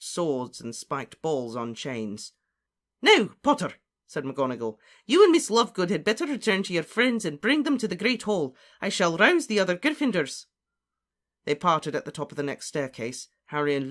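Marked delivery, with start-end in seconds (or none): 3.0-3.7 high, loud address; commanding professor's voice; brisk command. Acted. Professor turns briskly to pupil. 5.1-10.5 higher, louder voice of authority; raised, businesslike character voice; brisk commanding professor. Acted. McGonagall gives orders in story.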